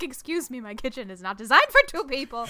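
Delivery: mock indignation. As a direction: act put out about your invaded space. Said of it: "I'm like".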